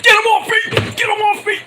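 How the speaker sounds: high, loud, panicked shriek; panicked scream. Retold or imitated. Announcer grabbed by the wrestler, shrieking in a loud, high panic. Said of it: "Vic Joseph screams like a little bitch".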